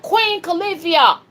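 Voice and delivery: "Queen Califia" called out high and loud; showing the chat how loud to say it; shouted name. Shown as a demonstration. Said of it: "real loud".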